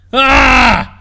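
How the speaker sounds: loud, high rage cry; furious scream. Acted. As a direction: erupt as you stab. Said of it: "the bald man screamed".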